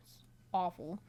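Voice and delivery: ordinary delivery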